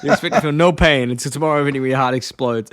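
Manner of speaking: chuckling over a favourite line; laughing while quoting. Real, not acted. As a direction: quote it through real laughter.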